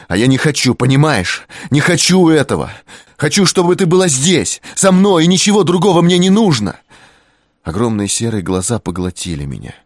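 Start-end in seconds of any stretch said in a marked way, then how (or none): 0.1-6.8 loud, high insistent; passionate plea. A narrator's reading. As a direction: pour it all out. Kris pleading loudly.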